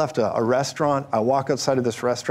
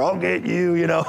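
trucker voice; 5 semitones higher than usual. Retold or imitated. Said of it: "speak with the voice of a trucker".

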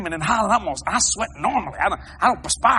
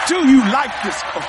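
louder and higher second ask; loud repeat; 5 semitones higher, 8 dB louder than usual. Retold or imitated. Preacher offering his coat to the king, calling out. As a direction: call it out to the king.

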